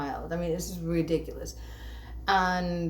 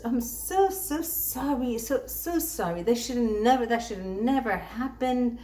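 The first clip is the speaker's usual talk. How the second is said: higher, slow, overdone sorry from a bank lady; mock apologetic agent; 4 semitones higher than usual. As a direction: ham up the sugary apology.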